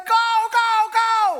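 high, loud sideline yelling; shouting coach. Retold or imitated. Coach yelling at his son's team, loud. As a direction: yell at the kids to win. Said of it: "I was like".

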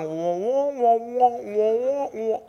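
muffled "wah wah" like an adult in a cartoon; mocking pastor noise; mock droning pastor. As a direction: drone meaningless "wah wah" sounds. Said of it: "I hear the pastor up there going".

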